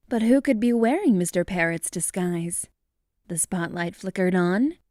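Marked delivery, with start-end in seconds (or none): none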